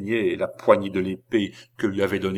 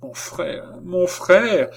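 profonde douleur; 9 semitones higher, 4 dB louder, much slower than usual. A narrator's reading. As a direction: call high for your brother in pain. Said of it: "exprima la plus profonde douleur". (appel douloureux qui s'amplifie)

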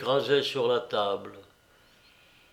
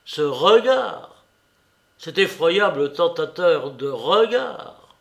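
loud, raised, impassioned exclamation; violent outcry; 4 semitones higher, 7 dB louder than usual. A narrator's reading. Alienist condemning the portrait's terrible gaze, violent outcry. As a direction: cry it out vehemently, surging on the key words. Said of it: "Violemment, il s'écria".

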